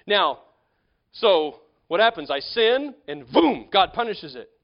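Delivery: loud, driving preacher voice; forceful preaching. Real, not acted. Preacher warning his congregation, loud and driving. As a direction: press the point home to the congregation.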